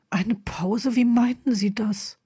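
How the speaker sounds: confused whisper. Acted. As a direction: lean in and whisper, puzzled. Confused man leaning in.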